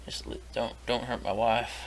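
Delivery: breathless whispered begging; whispered plea. Acted. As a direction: whisper it, pleading.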